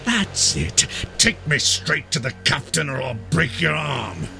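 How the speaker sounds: high, cruel snarled threat; sneering threat. Acted. Blind man threatens the boy with a cruel shout. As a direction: threaten to break his arm, shouting. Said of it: "he sneered".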